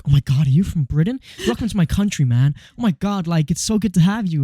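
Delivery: put-on effusive American accent. American greeting a British visitor in the street, mock American accent. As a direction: imitate a gushing American welcoming a Brit. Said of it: "Is that your American accent?".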